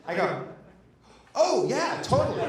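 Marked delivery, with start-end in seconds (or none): 1.3-2.4 high, loud, breezy agreement; blithe emphatic confirmation. Retold or imitated. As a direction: confirm it far too cheerfully. Retold blithe answer to scared friend.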